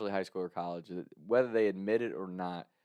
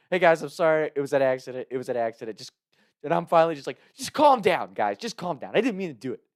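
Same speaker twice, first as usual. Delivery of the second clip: raised, pleading voice trying to calm a crowd; loud pleading apology; 5 semitones higher, 7 dB louder than usual. Retold or imitated. Drunk student pleads with the angry guys, loud and pleading. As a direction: beg them to calm down, louder and louder.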